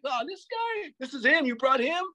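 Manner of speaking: very high, derisive imitation; mocking disbelief. Older dancers laughing at a kid challenger, high mocking disbelief. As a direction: scoff in a high voice at the newcomer. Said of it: "They would laugh at me".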